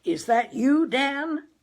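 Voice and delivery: father calls loudly into the receiver, unsure of phones. A narrator's reading. Father shouting on early telephone.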